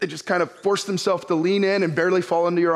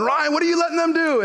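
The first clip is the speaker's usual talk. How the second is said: higher, fast mock yell of the wife; 9 semitones higher, faster than usual. Wife yelling at husband over kids jumping, mock yell. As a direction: toss off the wife's alarmed yell, half-hearted. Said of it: "your wife is yelling".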